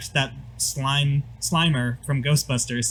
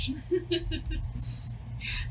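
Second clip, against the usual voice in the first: high breathy giggle; 12 dB quieter than usual. Real, not acted. GM cracks up.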